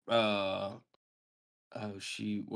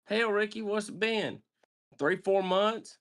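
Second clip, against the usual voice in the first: higher, casual clueless father; Reese's careless dad voice; 10 semitones higher than usual. Retold or imitated. Reese Bobby greeting his son at school, high and offhand. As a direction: toss it off like a dad who lost track. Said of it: "And then Reese goes".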